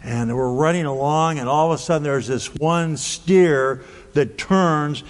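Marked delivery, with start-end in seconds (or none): none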